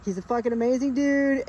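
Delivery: normal talking voice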